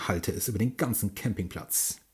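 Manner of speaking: usual speaking voice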